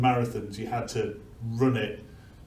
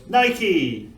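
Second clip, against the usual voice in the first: higher, half-hearted retold shout; mock shout; 6 semitones higher, 4 dB louder than usual. Runner announcing victory to the Athenians, mock shout. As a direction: call it out like a mild victory cry. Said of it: "loudly shouts".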